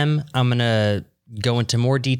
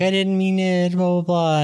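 tearful apology; 8 semitones higher than usual. Retold or imitated. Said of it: "she's like".

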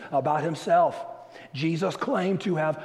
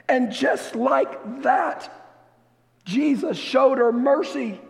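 higher, emphatic preacher's climax; impassioned preaching; 6 semitones higher, 4 dB louder than usual. Real, not acted. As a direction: proclaim it to the whole church with mounting force.

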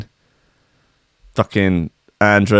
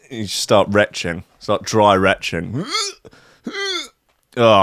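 usual speaking voice

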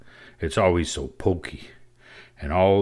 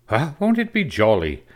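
high, louder piping twins; excited exclamation; 8 semitones higher, 4 dB louder than usual. A narrator's reading. Twins exclaiming over the Christmas plan, high and excited. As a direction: burst out as delighted children. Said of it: "exclaimed the twins".

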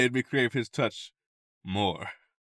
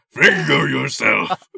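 slow, louder put-on command; silly voice; 5 semitones higher, 11 dB louder, slower than usual. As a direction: ham up the command with a voice. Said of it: "Just do a voice".

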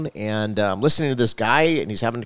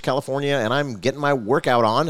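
ordinary talk

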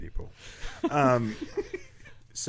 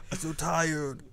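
high, giggling through the words; laughing it out; 8 semitones higher, 3 dB louder, slower than usual. Real, not acted. Laughing after joke.

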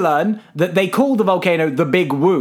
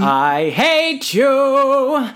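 high, long drawn-out playful groan of words; mock hatred; 7 semitones higher, much slower than usual. To co-host after his grand remark on film, mock hatred. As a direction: stretch every word out high, in pretend loathing.

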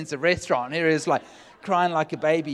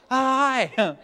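a much higher, louder, caught-out hi; startled hi; 10 semitones higher, 7 dB louder than usual. Retold or imitated. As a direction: squeak a surprised greeting. Waiter is right behind him.

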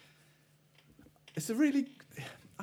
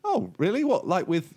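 higher, surprised rabbit; 9 semitones higher, 3 dB louder than usual. Acted. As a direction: voice the rabbit, curious. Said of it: "He says".

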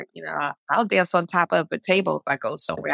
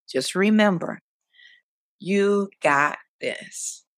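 encouraging message. Pretend. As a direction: give each phrase weight and let it land. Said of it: "what words would you want".

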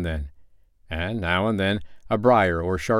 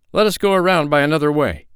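higher, louder fearful plea; afraid; 7 semitones higher, 6 dB louder than usual. Acted. Second traveler, afraid of the roaring, pleads. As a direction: urge a detour in fright. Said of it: "he said".

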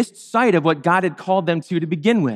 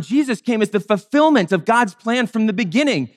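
ordinary delivery

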